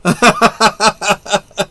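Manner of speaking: high, steady run of ha-ha-ha; real laugh. Real, not acted. Watching a film with friends, amused, high rattling laugh. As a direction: laugh out in a string of high pulses.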